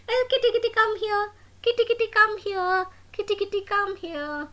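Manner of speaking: high, loud calling out; calling kitty. Acted. Prabhu calling the runaway kitty, high coaxing call. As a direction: coax the cat back, up high.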